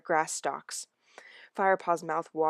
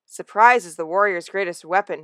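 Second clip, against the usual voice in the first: crowing; 8 dB louder than usual. Acted. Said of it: "he crowed".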